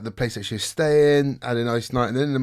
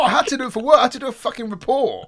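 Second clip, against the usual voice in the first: worked up; 13 semitones higher, 4 dB louder than usual. Real, not acted. Still riled over the accusation.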